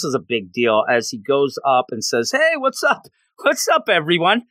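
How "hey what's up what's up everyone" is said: higher-pitched, acted-out casual hello; breezy greeting. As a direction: call out a mock carefree greeting. Imitating a casual greeting on arrival.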